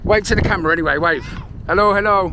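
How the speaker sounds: loud, high, cheery call; loud greeting across the street. Real, not acted. Calling to kids to wave.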